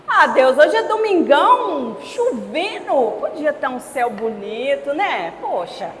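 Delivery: slow, drawn-out, sing-song complaint; mock whiny grumbling. Preacher mimicking believers complaining to God about rain, whiny grumbling. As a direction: imitate someone moaning, stretching the key words.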